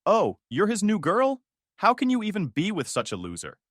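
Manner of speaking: put-on sister-in-law taunting the new girlfriend, sneering. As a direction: mimic a snide, contemptuous sister. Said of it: "She said".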